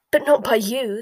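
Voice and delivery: loud, furious retort. A narrator's reading. Bellatrix accuses Snape of shirking, furious. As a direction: hurl the accusation back at him. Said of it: "said Bellatrix furiously".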